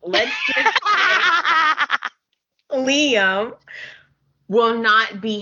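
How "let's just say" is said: high, laughing outcry; shrieking laughter. Real, not acted. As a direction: squeal her name through laughter.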